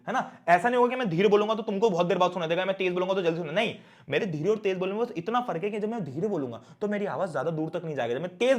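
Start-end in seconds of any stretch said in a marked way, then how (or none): none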